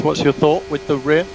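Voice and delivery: normal talking voice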